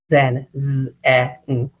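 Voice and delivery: "zen", then z, eh, n one by one; stretched-out sounds. Shown as a demonstration. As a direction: sound out "zen" slowly with a long buzzing z. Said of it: "you stretch out those sounds".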